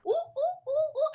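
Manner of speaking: high laughing monkey hoots; monkey calls. Pretend. Teacher hoots like a monkey for the kids. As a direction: hoot like a giggly monkey. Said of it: "What do monkeys sound like?".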